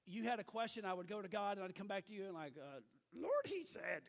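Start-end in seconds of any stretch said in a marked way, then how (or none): 3.1-4.0 a light, higher Yoda voice, a bit louder; Yoda impression. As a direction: play a wise little Jedi master, lightly. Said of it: "I kinda had some Yoda spirituality about me".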